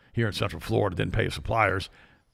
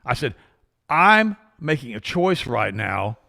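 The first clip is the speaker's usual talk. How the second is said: firm refusal; 5 semitones higher, 6 dB louder, slower than usual. Retold or imitated. Retold confrontation in the car.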